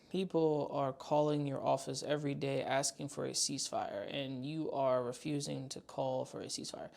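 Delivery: low, soft mocking parroting of the protester; deadpan low parody of the woman's plea. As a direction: repeat her plea back in a low, muttered mock. Repeating the protester's words mockingly.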